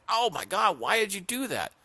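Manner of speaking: high, stunned frog voice; a frog stung mid-river, high and shocked. Acted. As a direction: gasp out the betrayal. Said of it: "The frog's like".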